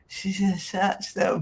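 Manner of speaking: ordinary talk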